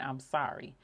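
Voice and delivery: ordinary talk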